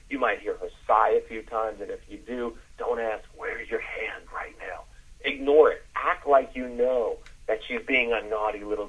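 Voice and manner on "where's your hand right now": slow, whispered question; hushed question. Acted. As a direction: ask it in a slow, soft whisper. Sample bedroom question to her.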